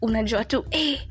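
normal talking voice

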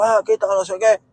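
high mocking squeal of the angry driver; mocking the bus driver. Mocking the bus driver who honked at him, high squeal.

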